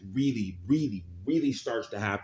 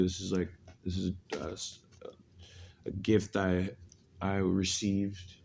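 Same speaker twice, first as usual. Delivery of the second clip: mystical horoscope reading; 4 semitones lower, 7 dB quieter than usual. Acted. Hushed devotional drawing ritual.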